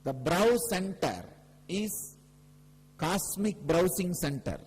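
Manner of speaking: high, drawn-out proclamation, phrase by phrase; slow, forceful spiritual proclamation; emphatic preaching. Real, not acted. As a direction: stretch each key phrase and declare it to the whole gathering. Preaches the main teaching.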